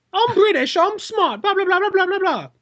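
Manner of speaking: very high, sing-song mockery; mocking British voice. Director mimicking the actress with a squeaky parody. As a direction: prance through it in a silly voice. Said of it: "kinda like".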